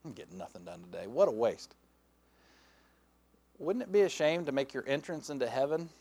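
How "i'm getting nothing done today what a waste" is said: low, soft grumble to oneself; understated guilty self-reproach, dropped low; a little guilty. Acted. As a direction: mutter it with mild, acted guilt. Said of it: "you still feel a little guilty".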